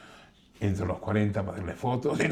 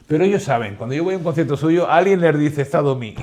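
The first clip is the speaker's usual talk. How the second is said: louder and higher, proudly; telling the host stars know he is there, loud; proud emphasis; 6 semitones higher, 10 dB louder than usual. Real, not acted. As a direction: build to the punchline with pride. Proud climax of anecdote.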